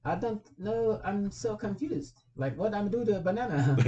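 confused prank caller in a high little voice. Retold or imitated. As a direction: squeak it out like a baffled little man. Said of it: "And he saw in his little voice".

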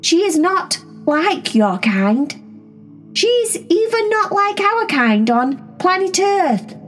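slow, deliberate words for strangers; grand slow announcement; slow formal introduction. Acted. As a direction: speak slowly so the aliens understand. Introducing herself to alien cats.

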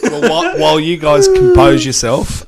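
laughing while talking. Real, not acted. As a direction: talk through your laughter. Co-host laughing through line.

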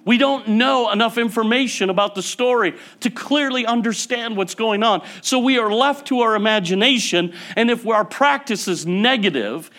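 high, loud, driving sermon voice; fiery preaching. Acted. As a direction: preach it hard to the church. Preaching on sarcasm, rising intensity.

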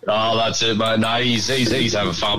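ordinary delivery

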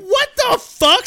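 high, slow, stunned what the fuck; shocked. Retold or imitated. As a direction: stretch it out in disbelief. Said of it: "And I went".

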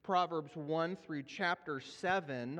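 usual speaking voice

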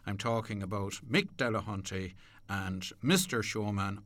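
ordinary talk, nothing marked

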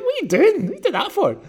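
high, laughing incredulous question; half-laughing mock outrage at the screen; laughing at the absurd moment; laughing disbelief. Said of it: "And I'm like".

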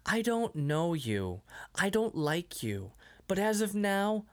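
slow, deliberate mock-menace; slow tough-guy line. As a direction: lay it out slowly like a cheesy threat. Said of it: "it's so stupid".

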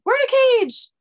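very high, loud squeak; high-pitched voice. Acted. Captive explaining to rescuers, high-pitched squeal. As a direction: squeal it out in a tiny voice. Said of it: "another high-pitched voice says".

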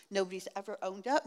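usual speaking voice

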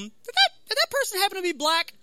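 high, put-on careful asking; tentative question. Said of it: "And then I'm like".

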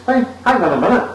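high, thin feeble protest; a spineless hero squeaking up; weedy objection. Retold or imitated. Hitchhiker confronts wife beater.

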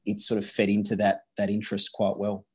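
usual speaking voice

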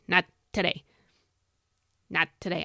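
lightly put-on Michigan accent. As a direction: quote the coach with a hint of Michigan accent. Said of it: "do it in that accent if you can".

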